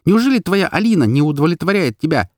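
high, exasperated outburst; heated, wailing reproach; hurling accusations. A narrator's reading. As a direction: fire accusing questions, pitched high. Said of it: "сыпала я, задыхаясь обвинениями".

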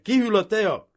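loud and high call. A narrator's reading. Girl calling her friends to tea, loud. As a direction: call across the lawn. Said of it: "kiáltotta oda a társnőinek".

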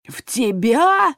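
slow drawn-out roar. A narrator's reading. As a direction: bellow it in outrage. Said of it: "взревел Мира".

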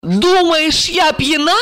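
high-pitched offended outcry; frantic cry. A narrator's reading. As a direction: cry out in a voice not your own, high. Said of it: "не своим голосом закричала Ольга".

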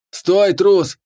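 loud high yell, stress on трус; shouting. A narrator's reading. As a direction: yell it down the stairs. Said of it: "кричал, спускаясь по лестнице".

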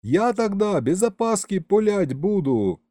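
high-pitched calling out; yelling. A narrator's reading. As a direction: keep calling up to someone far away. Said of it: "заорал я".

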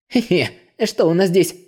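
gloating snicker over the bag; nasty snicker. A narrator's reading. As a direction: snicker like a petty thief. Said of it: "мерзко засмеялся про себя он".

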